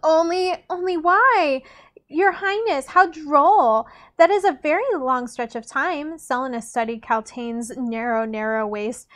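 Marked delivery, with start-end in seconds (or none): 0.0-6.2 higher-pitched, amused society lady; arch court lady. A narrator's reading. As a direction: exclaim it with courtly mock delight. Kaltain's arch reply to Dorian.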